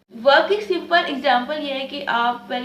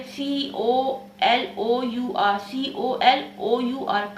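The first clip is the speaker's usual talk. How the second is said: slow spelling; much slower than usual. Shown as a demonstration. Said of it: "spelling out colour letter by letter".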